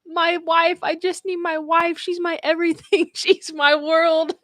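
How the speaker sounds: high, mock-wailing devotion; pleading husband. Nurse quotes the patient pining for his wife, high mock plea. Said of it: "was making me cry 'cause he kept saying".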